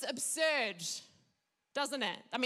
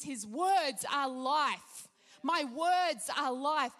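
slow declaration; slower than usual. Acted. Preacher declaring scripture slowly.

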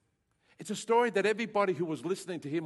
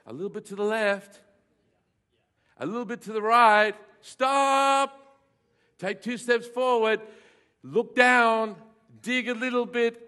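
put-on louder and higher, calling out directions to the children; parents calling out; 5 semitones higher, 8 dB louder than usual. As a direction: guide the kids excitedly across the yard. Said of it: "we'd go".

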